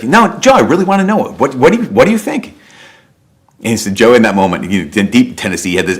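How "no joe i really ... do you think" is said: much higher, mocking coworker voice egging him on; pushy coworker. Coworker Richard pressing Joe to answer, high and goading. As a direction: needle him like a pushy buddy.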